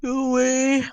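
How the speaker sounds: high, loud plea against a sneeze; holding back a sneeze. Real, not acted. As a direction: order the sneeze away, strained. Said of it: "Must not sneeze".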